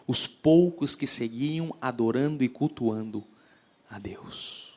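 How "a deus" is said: whisper. Acted. Sermon climax dropped to whisper.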